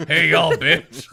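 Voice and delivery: Southern drawl through laughter; Southern accent. Acted. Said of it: "I like Southern for the group".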